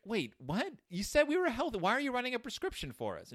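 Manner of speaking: put-on high, incredulous child's protest; indignant kids. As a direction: squeak out the outrage like a cub.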